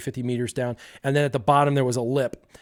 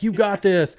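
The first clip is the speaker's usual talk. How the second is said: higher-pitched pep talk; pride talking; 6 semitones higher, 3 dB louder than usual. Acted. Pride eggs the kid on, pitched up. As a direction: cheer yourself on as pride's voice. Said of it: "pride's like".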